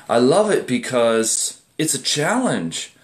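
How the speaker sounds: ordinary talk, nothing marked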